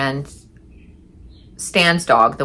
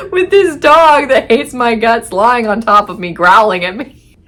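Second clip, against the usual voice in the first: high, loud telling through laughter; laughing incredulity; 7 semitones higher, 6 dB louder than usual. Real, not acted. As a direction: tell it loud, barely holding back laughter. Laughing at absurd dog situation.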